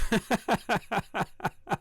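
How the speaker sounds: a loud choked laugh after holding back grief; emotional burst of laughter; teary laugh. Real, not acted. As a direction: laugh it off through tears. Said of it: "I'm tearing up a little bit".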